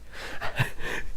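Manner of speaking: a quiet giggle; a breathy little chuckle; 1 soft breathy laugh. Real, not acted. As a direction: laugh softly at yourself. Laugh after the story.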